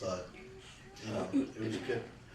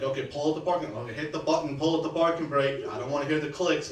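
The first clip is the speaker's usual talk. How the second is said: bossy instructions; 4 semitones higher, 6 dB louder than usual. Retold or imitated. Mimics sister's driving orders.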